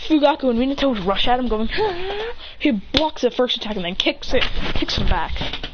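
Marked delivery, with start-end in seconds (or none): 1.8-2.3 mock battle cry. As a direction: imitate a charge cry, held back. Fathers charge yelling.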